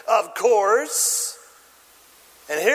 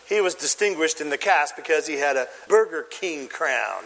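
normal talking voice

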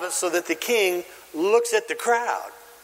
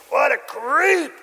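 high, drawn-out accusation; mock disgust; 8 semitones higher, slower than usual. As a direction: sneer at him as if pointing. Acting the finger-pointing accuser.

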